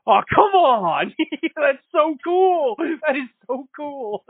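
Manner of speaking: high, slow, laughing awe; gushing over the coolest fight ever; stretched-out squeal of delight; giddy excitement. Retold or imitated. Reliving an amazing fight scene.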